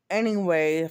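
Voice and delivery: normal talking voice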